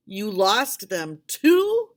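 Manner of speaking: drawn-out, loud incredulous question; the chairman scolding the doctor, slow loud disbelief. Acted. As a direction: stretch it out in outrage.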